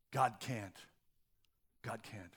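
hushed, low inner thought; unspoken belief. Acted. Preacher voicing Abram's hidden doubt, quiet and low. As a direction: let it out quietly, like a secret doubt. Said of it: "Abram's unspoken belief".

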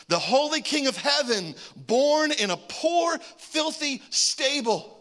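high, loud, slow preaching; preacher building to the sermon climax, high and slow. Acted.

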